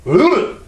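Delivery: short high grunt for the wheel jerking; mock strained ugh; imitated wheel jolt grunt. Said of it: "the wheel goes".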